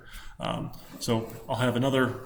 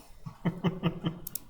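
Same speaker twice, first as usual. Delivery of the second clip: quiet breathy laugh; 6 semitones higher, 6 dB quieter than usual. Real, not acted. Embarrassed after forgetting screen.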